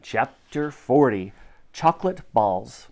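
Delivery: ordinary delivery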